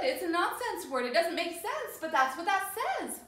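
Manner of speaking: normal talking voice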